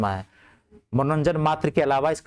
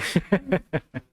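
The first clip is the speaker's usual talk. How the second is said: laughing; 3 semitones higher than usual. Real, not acted. Laughs after story.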